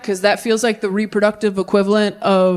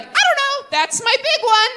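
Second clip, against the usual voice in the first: put-on clueless kid voice; 13 semitones higher than usual. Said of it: "I just walked around all day like".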